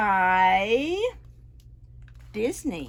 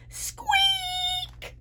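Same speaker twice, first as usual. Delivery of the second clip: mouse squeak. Acted.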